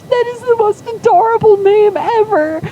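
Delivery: tearful gushing, very high and loud; Zoran. Retold or imitated. Uncle hearing the baby's name, high tearful gush. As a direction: squeal it, overcome with adoration. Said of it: "he is just in tears".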